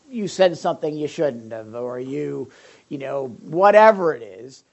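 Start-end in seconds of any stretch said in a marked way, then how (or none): none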